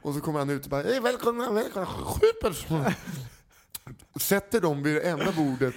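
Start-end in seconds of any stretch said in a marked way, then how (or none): 0.8-3.0 high, singsong welcome; overly eager host. Retold or imitated. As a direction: greet the guests with forced cheer, far up high. Owner greets the big group.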